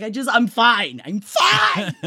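high, shouting I'm fine, I'm fine; mock insistent. To husband, insisting she's fine in a high shout. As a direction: protest it's fine in a cracking high voice. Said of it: "I was like".

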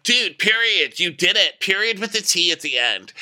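high, emphatic mock hype; a hint of a sassy bestie; sassy cheer. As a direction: cheer him on with sass. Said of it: "Maddie's like".

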